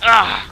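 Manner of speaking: sudden loud pained grunt; mock groan of a fallen soldier; enemy groan. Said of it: "they're like".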